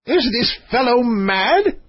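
high, slow, indignant bawl without much volume; bawling king. Acted. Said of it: "bawled the king".

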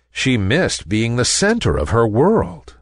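ordinary delivery

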